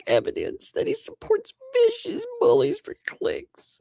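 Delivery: squeaky falsetto mock reading; extremely high, put-on cracking voice; cracking boy voice. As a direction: read it out as a prepubescent boy. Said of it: "You need to do the voice cracking for him".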